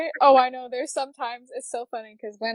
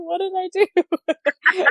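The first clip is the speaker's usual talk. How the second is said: slow, louder, high mock despair; drawn-out mock regret; 8 semitones higher, 4 dB louder, slower than usual. Haircut gone wrong, slow high mock despair. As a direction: stretch the regret out. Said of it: "You're gonna be like".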